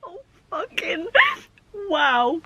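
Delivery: a broken, tearful gasp of a curse; real tears catching the voice; choked-up cry. Real, not acted. Crying over sad book ending.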